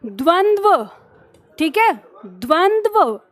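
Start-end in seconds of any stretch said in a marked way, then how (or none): 0.1-0.9 द्वंद्व said as dvandva, both d and v joined in each half; careful pronunciation. Shown as a demonstration. Teacher models द्वंद्व as dvandva for students. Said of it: "इसका उच्चारण क्या है?". 2.3-3.2 द्वंद्व said slowly as dvandva, the v right after each d; slow careful pronunciation. Shown as a demonstration. Teacher repeats द्वंद्व slowly to fix it for the class. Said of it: "व बाद में, अंत में।".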